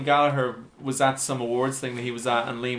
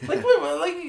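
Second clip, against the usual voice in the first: laughing disbelief. Real, not acted.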